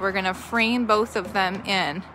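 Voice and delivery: ordinary talk, nothing marked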